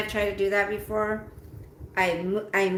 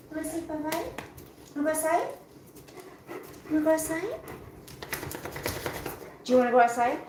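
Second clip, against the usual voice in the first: talking to a dog; 3 semitones higher, faster than usual. Real, not acted.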